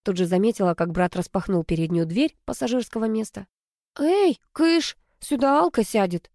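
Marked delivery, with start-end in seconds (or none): 4.0-6.3 high-pitched call to clear off; shooing. A narrator's reading. Sister saving the front seat for friend, shooing. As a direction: shoo him away in a lifted voice.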